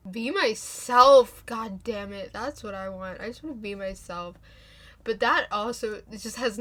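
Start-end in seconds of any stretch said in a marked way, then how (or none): 0.1-1.4 loud drawn-out. Real, not acted. Wants to be herself.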